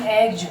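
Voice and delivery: "एग्ज" ending in voiced j; final J sound. Shown as a demonstration. Teacher explaining the sound after egg, final J sound. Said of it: "जा sound देगा".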